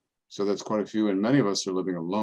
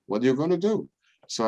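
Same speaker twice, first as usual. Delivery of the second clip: resigned parishioner; 6 semitones higher, 4 dB louder, faster than usual. Retold or imitated. Every parishioner says same.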